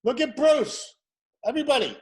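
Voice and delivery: loud, high call across the room. Real, not acted. Host calls the crowd to look at Bruce, loud and high.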